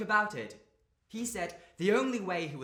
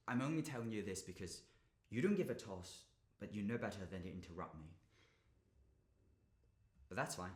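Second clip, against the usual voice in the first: very soft, low and withdrawn; deflated, near-murmured small talk; resigned confiding; 6 semitones lower, 10 dB quieter than usual. Acted. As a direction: wind down quietly, spent. Resigned confiding to silent listener.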